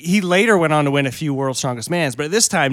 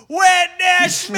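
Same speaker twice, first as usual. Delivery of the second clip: long, high, loud battle cry; yelled call; 5 dB louder, much slower than usual. Retold or imitated. Storyteller re-enacting his yell to costumed strangers, drawn-out high shout. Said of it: "and I just yelled".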